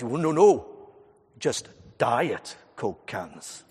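put-on slow, earnest Welsh teen voice, proud of his cans; mock-serious Welsh lilt, drawn out; Welsh teenager. Welsh teenager showing off his collection, in a slow mock-earnest Welsh accent. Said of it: "attempting your accent".

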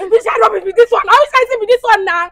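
high, loud angry yelling; furious shout. Real, not acted. Woman yelling furiously at the man who ruined her outfit. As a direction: let the anger explode at him. Victim raging at prankster.

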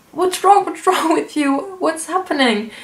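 a startled, caring father; worried dad. Retold or imitated. Dad asks his crying daughter what's wrong, high and alarmed. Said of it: "my dad was like".

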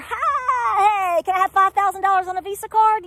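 high, loud, fast put-on request; imitated migrant. Vlogger mimicking a migrant asking for money, high and loud. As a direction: call out cheerfully for the cash.

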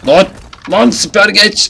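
loud, high-pitched urgent yell; urgent shout. Real, not acted. Player under fire, urgent shouted order. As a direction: scream the order to take cover. Ordering character to take cover.

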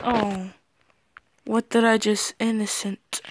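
ordinary talk

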